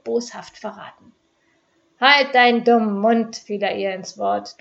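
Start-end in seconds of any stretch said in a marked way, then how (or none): 2.0-3.4 angry. Acted. Husband cuts wife off angrily.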